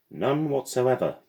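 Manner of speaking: a clipped firm denial from the guardian; curt denial. Acted. Madame cuts Lily off.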